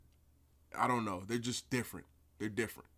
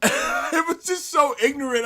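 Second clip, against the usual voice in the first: laughing in disbelief; 12 semitones higher, 8 dB louder than usual. Real, not acted. Laughing at the insult.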